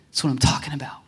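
hushed, voiceless aside; whispered reaction. Retold or imitated. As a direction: whisper it as a private little victory. Retells his reaction, whispered.

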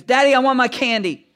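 a hint of a toddler asking for candy; daughter's voice. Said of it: "and she goes".

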